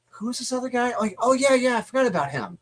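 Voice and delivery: high-pitched puzzlement turning into quick recognition; sudden recollection. Retold or imitated. Reader jogging his memory about the other guy, sudden recollection. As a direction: play out remembering someone you forgot. Acting out recalling forgotten character.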